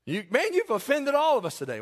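high-pitched indignant protest; mock-offended churchgoer. Preacher voices an offended congregant, indignant.

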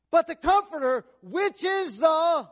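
emphatic, drawn-out key words; fervent scripture preaching. Real, not acted. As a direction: proclaim each phrase to the congregation.

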